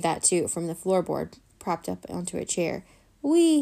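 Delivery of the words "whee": high, loud joyful shout; shouted whee. A narrator's reading. Boy on his skateboard shouting with glee. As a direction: whoop it out on the ramp. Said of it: "he shouted".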